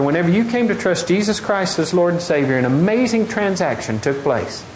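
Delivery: normal talking voice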